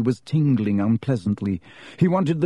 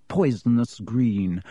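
ordinary delivery

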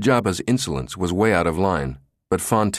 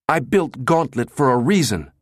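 higher, put-on angry voice; acted outrage, pitched up; enraged outburst; 6 semitones higher than usual. A narrator's reading. Jabba rounds on the director, enraged. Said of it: "wheeled like an enraged bull".

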